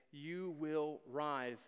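very slow, weighty decree; a king bestowing honor; solemn pronouncement. Acted. King solemnly elevates Mordecai.

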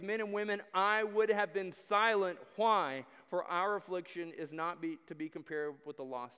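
loud, ringing plea to the king; preaching at full force. Acted. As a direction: cry it out like a desperate queen.

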